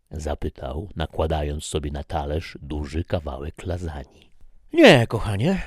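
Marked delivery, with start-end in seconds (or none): 4.7-5.6 much higher, louder snide reply with a rise on "nie"; sarcastic. Acted. Wife answering husband snidely, sarcastic. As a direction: bite out "Nie, kochanie" with sarcasm. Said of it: "odparła z przekąsem Maria".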